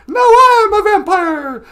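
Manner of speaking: loud, hammed-up villain proclamation; big mock-vampire cry; triumphant new vampire. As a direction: proclaim it like a storybook monster. Bitten Santa announces transformation.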